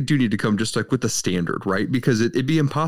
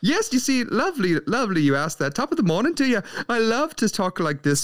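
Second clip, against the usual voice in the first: high, hammed-up Irish brogue; put-on Irish accent; 10 semitones higher than usual. Co-host asks why he's doing Irish voice, hammed-up Irish accent. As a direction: lay on a jolly stage Irish lilt. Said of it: "this half Irish, half Australian".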